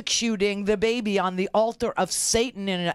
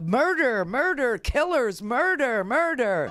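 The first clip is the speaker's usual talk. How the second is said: a mild mock yell of murder; mock screaming; 4 semitones higher, slower than usual. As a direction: imitate the rally chant of murder, half-hearted. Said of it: "He started screaming about".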